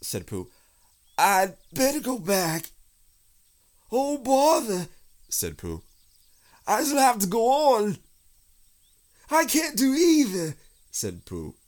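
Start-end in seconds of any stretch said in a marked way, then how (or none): 1.2-2.7 high, loud, flustered bear voice; panicked distress. Acted. Pooh, stuck climbing out of the hole, panicked. 3.9-4.9 high, loud wail from a stuck bear; panicked cry for help. Acted. Stuck Pooh calls for help, panicked. 6.6-10.6 high, loud, struggling bear voice; distressed wail. Acted. Pooh finds he is wedged both ways, distressed wail.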